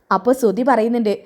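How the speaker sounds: plain reading voice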